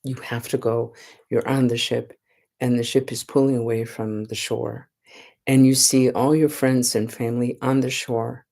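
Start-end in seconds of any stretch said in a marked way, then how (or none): none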